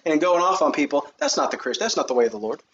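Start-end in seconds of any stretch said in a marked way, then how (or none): none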